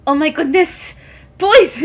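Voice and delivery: put-on high, loud cry of relief at the boys; big sister spotting her brothers, high loud relieved cry. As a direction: call out to the lost little brothers in a high voice. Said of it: "as she looks and goes".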